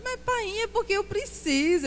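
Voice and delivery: very high, imitated wheedling voice; Tatiana pleading. Wife justifying her shopping to her husband, high mock pleading. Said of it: "O seu primeiro verbo é comprar, Tatiana".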